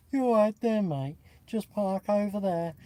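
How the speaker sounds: friendly Brummie car-park marshal, lightly done; Brummie accent. Retold or imitated. Greeting visitors at the mini show in a light Brummie accent. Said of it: "do a tiny little bit of accent".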